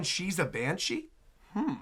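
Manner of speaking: ordinary talk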